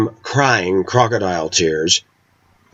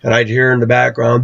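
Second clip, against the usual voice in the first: ordinary talk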